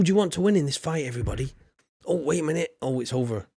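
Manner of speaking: usual speaking voice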